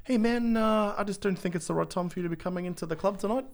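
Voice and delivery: high, fast, calm New Zealand voice; calm Kiwi bouncer. Retold or imitated. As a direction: politely turn someone away, Kiwi style. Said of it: "a three hundred pound bouncer going".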